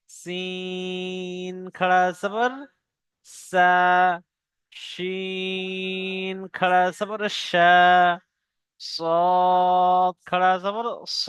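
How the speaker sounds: সা and শা held long, very slow; slow recitation drill. Shown as a demonstration. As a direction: intone each syllable slowly. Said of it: "পড়তে থাকবেন আমার সাথে সাথে".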